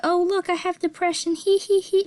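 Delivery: high, silly mocking giggle voice. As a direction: parody a flippant poster. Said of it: "It's not a".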